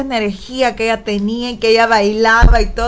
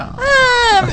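mock dismayed ah; 9 semitones higher than usual. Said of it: "se le quedaba viendo y le decía".

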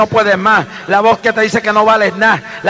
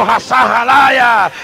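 shouted tongues; much slower than usual. Real, not acted. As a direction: shout it drawn out. Glosolalia gritada. (lenguas gritadas y lentas)

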